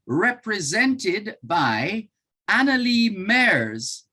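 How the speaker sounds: ordinary delivery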